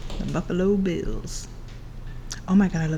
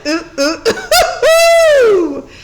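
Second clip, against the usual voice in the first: loud, high ooh-ooh whoops broken by laughter; laughing whoops; 9 semitones higher, 10 dB louder, slower than usual. Real, not acted. Streamer hooting along to the song chorus, laughing loud whoops. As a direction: hoot the oohs while cracking up.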